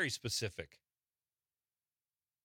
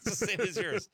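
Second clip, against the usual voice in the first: amused laughing echo; 7 semitones higher, 5 dB louder than usual. Real, not acted. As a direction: say it through a laugh.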